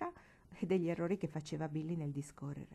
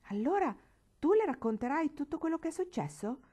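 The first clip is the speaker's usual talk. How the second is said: much higher, louder panicked shout; boy terrified his trick will be told; angry frightened cry; 9 semitones higher, 6 dB louder than usual. A narrator's reading. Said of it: "gridò egli pallido di collera e di paura".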